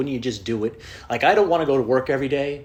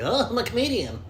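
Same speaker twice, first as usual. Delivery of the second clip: high, mocking self-important voice; mock a show-off beginner; smug new comedian; 11 semitones higher than usual. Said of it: "and I'm like".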